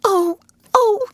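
two high gasps, spaced apart; frightened gasp. A narrator's reading. Ruth terrified by the weird howl, frightened gasp. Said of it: "gasped Ruth".

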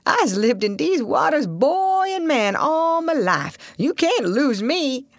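high, slow drawl; old Southern boatman, boastful. Acted. Old boatman boasting he knows these waters, slow drawl. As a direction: stretch the words out in folksy Southern dialect.

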